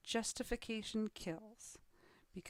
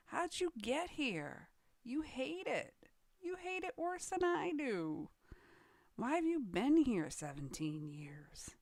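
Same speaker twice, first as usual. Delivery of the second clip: retold incredulity; 6 semitones higher than usual. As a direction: quote yourself asking in mock disbelief. Retold incredulous question to long-timers.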